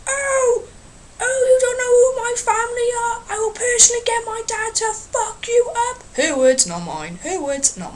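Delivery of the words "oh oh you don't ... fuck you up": high, cocky put-on girl's voice; mock threatening girl. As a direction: sneer like a drunk teen threatening a man. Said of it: "the girl decides to say".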